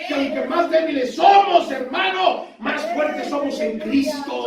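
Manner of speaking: high shouted pulpit climax; fervent preaching. Real, not acted. Preacher tells the congregation they are strong in Christ, fervent preaching. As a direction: build to a ringing cry, slowing on the last phrase.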